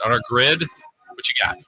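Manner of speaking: ordinary talk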